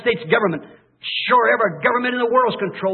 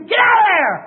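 much higher and louder warning yell; desperate scream; 12 semitones higher, 7 dB louder than usual. Retold or imitated. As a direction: scream it to warn them off the falls. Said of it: "and I'm screaming to you".

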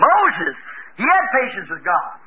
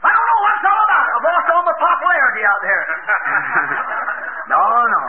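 mock outburst; 4 semitones higher than usual. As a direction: ham up a fretful rant. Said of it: "He didn't fly loose and say".

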